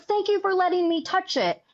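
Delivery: high, heartfelt thank you; a touch of tearful gratitude; grateful thanks. Retold or imitated. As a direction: imitate moved gallery visitors. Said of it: "And they're like".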